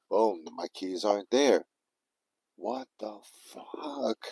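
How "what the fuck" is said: high, drawn-out stunned what-the; shocked disbelief. Retold or imitated. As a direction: squeak it out slowly in disbelief. Panicked realization, keys missing.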